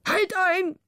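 high, sharp royal outburst; angry cry. A narrator's reading. King cutting off the letter, angry cry. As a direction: stop him mid-sentence. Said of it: "rief der König".

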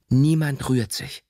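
slow, drawn-out command with mild force; restrained narrated shout. A narrator's reading. Teacher orders the frightened class to stay still, mildly shouting. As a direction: order the class firmly, stretching each word. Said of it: "schrie Herr Scher".

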